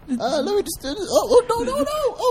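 high-pitched persona telling his story; chatty high character voice; high alter ego voice. Pretend. Alter ego narrates [name]'s life.

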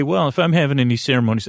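ordinary talk

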